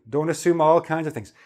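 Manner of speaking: ordinary talk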